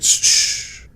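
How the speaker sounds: whispered soothing shushes; hushing. Retold or imitated. Host calming his annoyed wife, soft shushing. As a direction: shush her gently to calm her. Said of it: "I was like".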